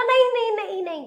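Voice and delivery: quick high alarmed no. A narrator's reading. Cobbler hastily stopping the giant from crying. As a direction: stop him in a hurry.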